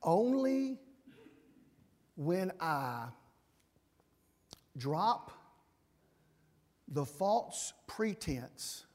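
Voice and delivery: very slow, spaced-out phrases; slow and deliberate. Shown as a demonstration. Preacher to the congregation, slow deliberate emphasis. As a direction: drag each phrase out for weight. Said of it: "It's not just that I like talking like this".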